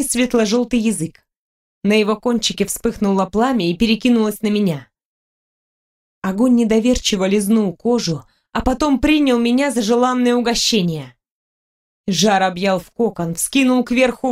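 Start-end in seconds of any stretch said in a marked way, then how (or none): none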